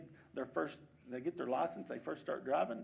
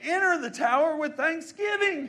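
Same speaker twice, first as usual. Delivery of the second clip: loud, high preacher's climax, held slow; fervent preaching shout; 8 semitones higher, 10 dB louder than usual. Real, not acted. Pastor exhorting his flock to give thanks, fervent shout. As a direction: cry it out to the congregation.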